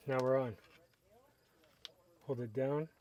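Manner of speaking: normal talking voice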